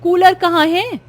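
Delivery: high, loud accusing calls; angry sister scolding the younger one; angry confronting. Acted. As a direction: shout at her sister for taking the cooler. Angry at sister about cooler.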